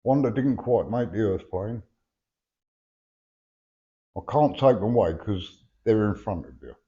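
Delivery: usual speaking voice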